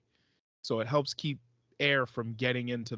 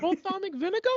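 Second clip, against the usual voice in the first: very high squeaky puppet voice; Elmo voice; 5 dB louder, faster than usual. Retold or imitated. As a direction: squeak it like a muppet in Brooklyn. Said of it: "The way he says it".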